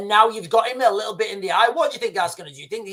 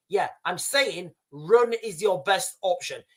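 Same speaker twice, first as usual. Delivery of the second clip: ordinary delivery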